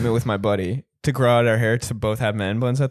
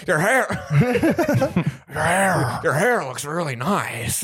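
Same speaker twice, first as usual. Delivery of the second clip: slow, drawn-out star impersonation; mock movie-star drawl; Nicolas Cage impression; slower than usual. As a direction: imitate the bar guy, stretching the words out. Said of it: "sounds like Nicolas Cage".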